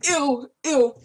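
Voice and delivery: loud grossed-out ew; disgusted. Real, not acted. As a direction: recoil at the taste. Disgusted by taste.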